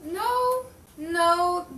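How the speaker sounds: high, loud insistent no; emphatic denial. Acted. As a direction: shoot the idea down hard. Witness denies the photo.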